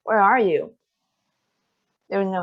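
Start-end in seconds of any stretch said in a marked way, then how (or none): 0.0-0.7 calling out. Acted. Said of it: "I called".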